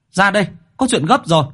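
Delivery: high and loud, pressing; urgent call. A narrator's reading. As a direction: hurry him out, something terrible happened. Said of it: "gọi to". (gọi gấp, giọng cao và to)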